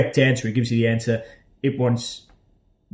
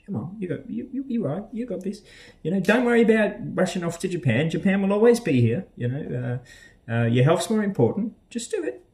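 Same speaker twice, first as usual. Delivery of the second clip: encouraging friend; 8 semitones higher than usual. Retold or imitated. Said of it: "keeps sending me messages going".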